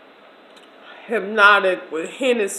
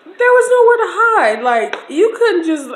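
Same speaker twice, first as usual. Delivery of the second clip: high, loud cry of dismay; distressed outburst; 11 semitones higher, 9 dB louder than usual. Retold or imitated. Recalling being trapped.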